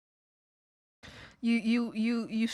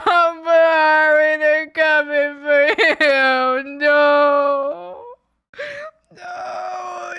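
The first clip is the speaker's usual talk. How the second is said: hammed-up desperate crying shout, much higher and louder; frantic pleading; 4 semitones higher, 7 dB louder, slower than usual. Chicken ghost begging a friend to order, frantic. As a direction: wail and beg as if doom is coming.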